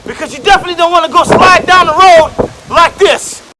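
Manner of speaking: loud shouted tips; shouting over wind. Acted. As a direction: yell it over a storm.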